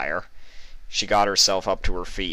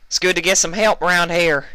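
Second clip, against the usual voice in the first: shouted over gunfire; 12 semitones higher, 7 dB louder than usual. A narrator's reading.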